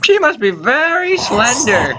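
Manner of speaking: put-on high, slow old-lady voice; old mother. Mother wondering about son's girlfriend, high old-lady voice. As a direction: muse slowly as a high-voiced old woman.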